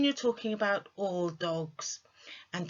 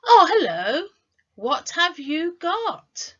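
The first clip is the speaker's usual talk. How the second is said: high, rising, slower "Oh, hello, what have you got?"; lively greeting; 12 semitones higher, 9 dB louder, slower than usual. Shown as a demonstration. Teacher models greeting a friend who carries something. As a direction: sound delighted to see them. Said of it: "we might go".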